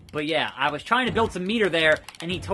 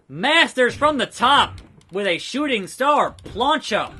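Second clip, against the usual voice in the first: slow, punched-out, raised match call; excited play-by-play; 4 semitones higher, 4 dB louder than usual. Pretend. Calling Masters' dive off top, excited. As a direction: hammer each move like a commentator.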